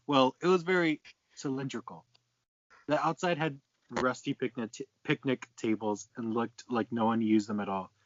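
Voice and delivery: normal talking voice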